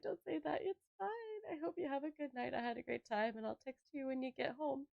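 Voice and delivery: soft, kindly retold goodbye; gentle reassurance. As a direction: reassure gently, imitating the date. Acts out date reassuring her.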